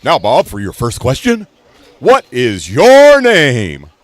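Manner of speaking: loud, high, slow quiz host voice; milk the question like a hammy TV host; booming game show question. Retold or imitated. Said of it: "he talked like that".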